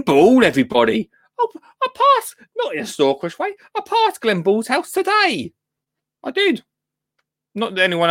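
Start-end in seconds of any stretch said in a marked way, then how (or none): 1.4-6.6 excited. Real, not acted. Excited news about passing house.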